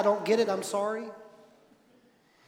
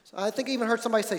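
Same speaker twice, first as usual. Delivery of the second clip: normal talking voice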